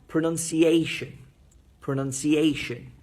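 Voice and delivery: "pronunciation" with a firm beat on "-a-"; strong syllable stressed. Shown as a demonstration. Teacher drilling word stress, "pronunciation" punched on "-a-". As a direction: drill "pronunciation", leaning hard on the fourth syllable, "a". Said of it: "when you say the strong syllable".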